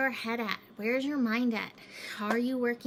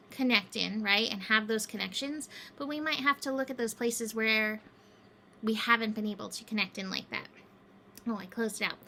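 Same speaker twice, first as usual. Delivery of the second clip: ordinary talk, nothing marked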